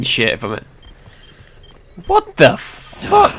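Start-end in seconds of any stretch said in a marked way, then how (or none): 2.1-3.4 shocked squeal. Real, not acted. Shocked in game.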